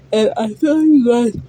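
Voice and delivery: speaking mid yawn; words pulled long by a yawn. Real, not acted. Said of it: "now I'm yawning for your reading".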